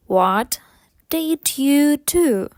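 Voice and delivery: slow, separated words. Shown as a demonstration. Teacher models the question for learners, slowly first. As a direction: stretch it out word by word. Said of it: "спочатку повільно".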